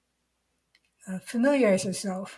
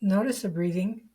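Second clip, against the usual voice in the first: guided meditation. Real, not acted. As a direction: lead the listener unhurriedly into the body, leaving long silences.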